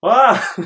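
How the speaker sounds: mock scream. Reacting to fake explosions.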